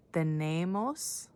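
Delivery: "Tenemos" drawn out slowly, syllable by syllable; slow model word. Shown as a demonstration. Teacher models "Tenemos" slowly for kids to repeat. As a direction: stretch out "Tenemos" for learners. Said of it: "Can you say that?".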